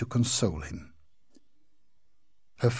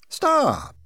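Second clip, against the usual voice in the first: sharp high outcry, much higher; cry out; 14 semitones higher, 5 dB louder than usual. A narrator's reading. Girl cuts off the insulting ghost with a loud cry. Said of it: "cried Virginia".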